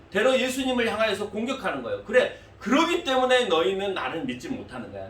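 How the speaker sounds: normal talking voice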